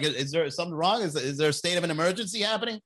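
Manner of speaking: usual speaking voice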